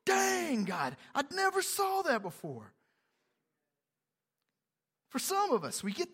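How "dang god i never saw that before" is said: high, drawn-out astonishment easing down; amazed discovery. Acted. Preacher voicing a reader struck by Scripture, amazed. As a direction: start high on "Dang", marveling.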